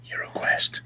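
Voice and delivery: soft, voiceless aside; hushed wink. Shown as a demonstration. Host slyly naming the unofficial set, hushed wink. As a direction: drop to a near-whisper on the name.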